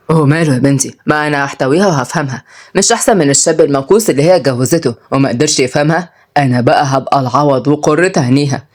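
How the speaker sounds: low grandfatherly banter; wry grandfather. Acted. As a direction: play a cheeky grandpa in a low voice.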